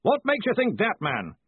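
forceful, impatient boss; blustering demand. A narrator's reading. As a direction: bark the question at a hesitant underling. Ridcully barks at the caller.

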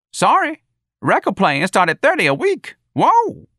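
high, loud comic character voice; whooping high on exclamations, loudly protesting; high-pitched, animated backtalk; Jones, brash and defiant. A narrator's reading.

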